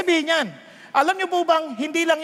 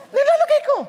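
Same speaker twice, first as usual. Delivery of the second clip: high put-on secretary; 10 semitones higher, 5 dB louder, faster than usual.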